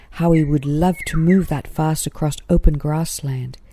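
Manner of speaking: usual speaking voice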